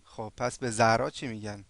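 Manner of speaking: ordinary talk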